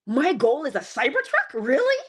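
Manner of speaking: loud, pitch leaping up on "Really"; mock disbelief at such a small goal; incredulous. Preacher looking around at humanity's goals, loud incredulous questioning.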